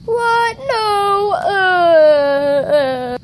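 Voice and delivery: upset recruit mock-crying over his wings; pretend dismay. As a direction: fake a crying protest. Told he cannot use his wings.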